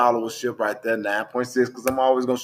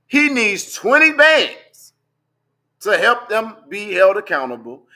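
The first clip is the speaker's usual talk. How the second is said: mocking disbelief; 6 semitones higher, 7 dB louder, slower than usual. Real, not acted. Mocking the GoFundMe amount.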